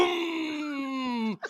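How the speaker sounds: vocal vroom of a revving engine; car engine vroom. Retold or imitated. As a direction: mimic the old car roaring to life. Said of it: "the car's like".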